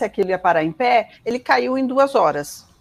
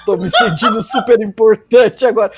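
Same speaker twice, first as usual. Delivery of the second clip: loud, laughing through the words; laughing self-mockery; 3 semitones lower, 6 dB louder than usual. Host retells her reaction to the strike news, laughing mock pride. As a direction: crack up while boasting in jest.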